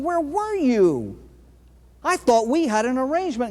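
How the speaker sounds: high aggrieved whine; miffed man. Acted. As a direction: play the miffed soul demanding answers. Miffed man confronts God.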